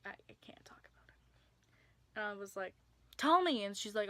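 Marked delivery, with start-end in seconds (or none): 0.0-1.1 fast, whispered, shaken; whispered quote. Retold or imitated. Friend in shock, quoted. 3.2-3.6 higher and louder, pressing; insistent demand. Retold or imitated. Pressing friend for answer.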